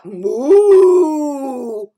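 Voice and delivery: loud, drawn out moo; cow moo. Acted. Storyteller voicing the cow, loud mooing.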